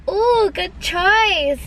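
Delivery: high, drawn-out drive-through worker impression; hammed-up, slow intercom approval in a put-on accent. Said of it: "he literally said it in that accent".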